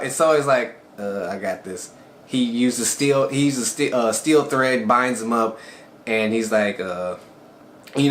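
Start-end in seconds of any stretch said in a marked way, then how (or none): 1.0-1.9 quiet, offhand and unbothered, much softer. Retold or imitated. Soe shrugging off the giant orc, offhand and quiet. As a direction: shrug it off like it's nothing. Soe calmly dismisses the danger.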